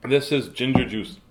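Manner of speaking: plain reading voice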